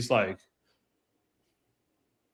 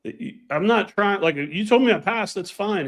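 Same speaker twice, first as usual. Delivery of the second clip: higher, incredulous and pleading; exasperated; 7 semitones higher than usual. Retold or imitated. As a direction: protest in disbelief. Exasperated retold reply to examiner.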